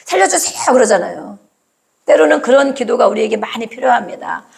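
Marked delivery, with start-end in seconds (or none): 0.1-0.7 high, urgent cry of "살려주세요!"; cry for help. Retold or imitated. 물에 빠진 사람이 살려달라고 외치듯 높은 외침. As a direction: call for help like a drowning man. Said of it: "살려달라고 하듯이".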